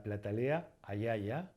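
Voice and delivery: "ayaya" slowly as a-YA-ya. Shown as a demonstration. Teacher giving an example, slow i-glide in "ayaya". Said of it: "la pronunciamos como I".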